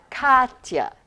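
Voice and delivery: "Katya" as KAHT-ya, strong then weak; stress on first syllable. Shown as a demonstration. Teacher contrasting two names, "Katya" stressed on the first syllable. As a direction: hit the first syllable of "Katya" and let it fall. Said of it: "But you say".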